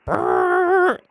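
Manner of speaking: high buzzing horn noise, mouth-made; imitated horn blare. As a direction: buzz it out high like a radio horn. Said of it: "And then you heard a horn. It was like".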